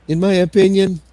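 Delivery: high mocking repeat. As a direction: squeak the phrase back to tease him. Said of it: "Emo repeated it".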